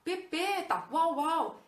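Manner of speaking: mocking childish speech to a child; baby talk. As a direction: coo at a toddler, mock babyish. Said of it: "falar de uma forma infantilizada".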